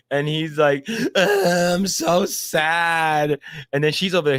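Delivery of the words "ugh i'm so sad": hammed-up tearful whimper; badly faked sobbing, words dragged out; mock crying. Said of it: "and he starts crying".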